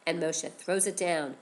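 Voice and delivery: ordinary delivery, nothing marked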